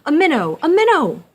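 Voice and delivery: loud, high delighted cry; excited cry. Acted. The frog thinks he caught a minnow, excited loud cry. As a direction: shout it like you've hooked a fish. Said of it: "cried Mister Jeremy Fisher".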